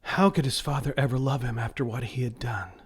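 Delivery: ordinary delivery, nothing marked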